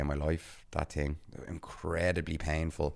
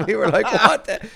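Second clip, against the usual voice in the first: laughing; 11 dB louder than usual. Retold or imitated. Shocked at Irish greeting.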